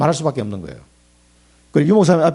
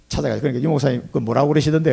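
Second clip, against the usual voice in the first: normal talking voice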